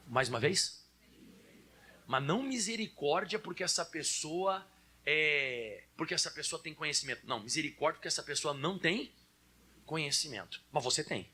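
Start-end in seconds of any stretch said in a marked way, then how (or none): none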